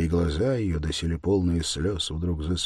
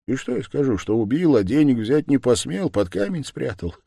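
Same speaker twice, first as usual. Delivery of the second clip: higher, scornful rhetorical question; caustic sneer; 7 semitones higher, 5 dB louder than usual. A narrator's reading. Man mocking his own confession to Sonya, caustic. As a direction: add it with a biting smirk. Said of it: "прибавил он с едкой усмешкой".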